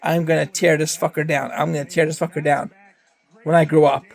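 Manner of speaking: usual speaking voice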